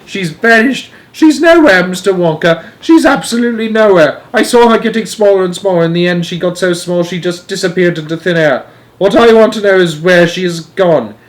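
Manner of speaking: high, loud, overwrought complaint; wailing. A narrator's reading. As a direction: pour out your distress in a wail. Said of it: "wailed Missus Bucket".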